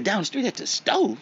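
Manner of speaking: astonished question; incredulous echo. Retold or imitated. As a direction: repeat it back like you can't believe it.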